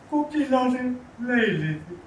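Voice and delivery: high, loud anguished cry; grief-stricken. Acted. Widower tells of wife's death.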